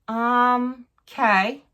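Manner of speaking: retold puzzled acceptance, rising at the end; dubious okay. Patient retells hearing about the lesion, dubious. Retells her hesitant reaction.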